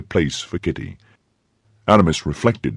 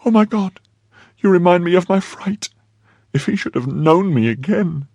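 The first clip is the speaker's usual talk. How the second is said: high-pitched alarmed cry; frightened maid Kitty; 12 semitones higher than usual. A narrator's reading. Maid remembering the spy, high and frightened. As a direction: gasp out the fear in a high voice.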